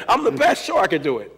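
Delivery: high, loud mock brag; cocky boast. Playing the confident kid, a loud cocky boast. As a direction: brag like the best in the world.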